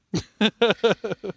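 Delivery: evil villain cackle; anime villain laugh. Pretend. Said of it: "give us an anime villain laugh".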